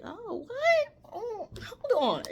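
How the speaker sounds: high, loud mock scammer gasp. Viewer-scammer reacting to leaked numbers, high mock surprise. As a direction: play a greedy scammer spotting the numbers. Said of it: "any scammers or anything to be like".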